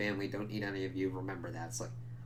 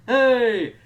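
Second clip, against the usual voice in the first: high, loud, goofy whooping; hammed-up celebration voice; mocking whoop; 14 semitones higher, 13 dB louder, much slower than usual. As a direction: cheer it like a clueless idiot. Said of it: "Instead of being like".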